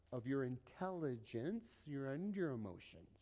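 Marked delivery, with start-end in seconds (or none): none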